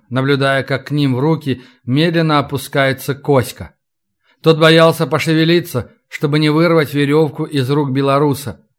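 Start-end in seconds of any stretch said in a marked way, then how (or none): none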